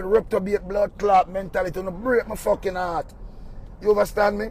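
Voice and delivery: ordinary talk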